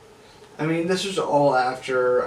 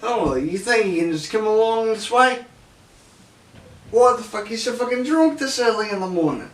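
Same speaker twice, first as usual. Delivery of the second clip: higher, louder mock Australian accent; retry the Australian properly, hammed-up; 9 semitones higher, 7 dB louder than usual. Australian mocking the drunk narrator on the path, hammed-up Australian accent. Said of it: "that's not the Australian accent, give me a second".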